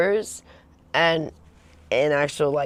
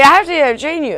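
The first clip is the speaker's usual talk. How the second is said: higher, louder mock trainer insistence; mock trainer boyfriend; 11 semitones higher, 10 dB louder than usual. Trainer boyfriend insisting on training girlfriend, higher louder mock voice. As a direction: ham up the pushy trainer. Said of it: "He's like".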